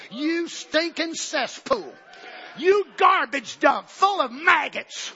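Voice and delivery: slow, loud scornful insult, drawn out; mock insult. Preacher scorning a gossiping church member, slow and loud. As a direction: spit the words out with disgust.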